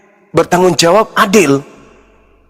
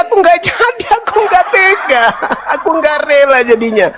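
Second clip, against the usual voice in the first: moved, laughing through tears; 8 semitones higher than usual. Retold or imitated. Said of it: "aku nangis".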